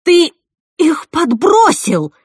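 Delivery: loud, high, slow accusation; drawn-out angry cry; accusing shout. A narrator's reading. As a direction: scream the accusation at him. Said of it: "закричала я на Джека".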